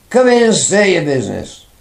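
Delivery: loud, high, gruff command; rough booming order. Retold or imitated. Sergeant ordering the visitor inside, loud and gruff. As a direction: bark it like a big policeman. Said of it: "very rough voice says".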